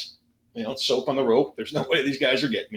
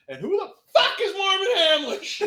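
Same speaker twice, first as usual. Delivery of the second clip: incredulous outburst. Acted. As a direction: voice leaping very high in disbelief. Mock outburst at unknown name.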